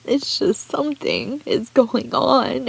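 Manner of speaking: high, breathless, sobbing whisper; barely getting words out through tears; crying whisper. Real, not acted. Vlogger to her viewers, whispering through sobs. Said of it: "will not apologize for crying".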